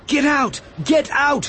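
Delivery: high, repeated warning cry; urgent shout. A narrator's reading. Obi-Wan running along the aisles, urgent shouting. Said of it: "he shouted".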